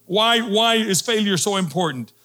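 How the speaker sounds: fervent pulpit exhortation; preaching at full force. Acted. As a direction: build it up to a climax. Preaching climax, rising pitch.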